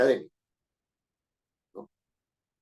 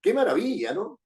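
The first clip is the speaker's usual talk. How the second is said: higher exclaimed wonder, lighter; exclamation; 9 semitones higher than usual. Shown as a demonstration. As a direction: exclaim it with delight. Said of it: "empiezo con una admiración".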